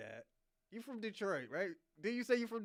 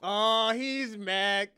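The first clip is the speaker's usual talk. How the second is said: mock drawn-out tease; 9 dB louder, much slower than usual. Real, not acted. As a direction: stretch it out slowly and loudly. Mocks the angry caller.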